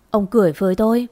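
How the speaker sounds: usual speaking voice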